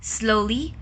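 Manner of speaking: usual speaking voice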